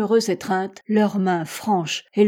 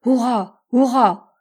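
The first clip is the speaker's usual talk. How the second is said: raised, loud hurrahs; cheering cry; 3 semitones higher, 6 dB louder, slower than usual. A narrator's reading. Clawbonny cheers as Hatteras is saved, crying out. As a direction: cheer out in triumph, louder on the second. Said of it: "s'écria Clawbonny".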